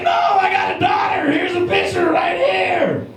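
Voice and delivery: high, loud desperate protest; loud, slow frantic explanation; panicked shout. Retold or imitated. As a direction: yell it, pleading innocence. Panicked defending himself to clerk.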